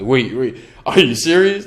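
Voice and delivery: can't believe the call is real; stunned disbelief. Retold or imitated. A surprised actor answering a casting call.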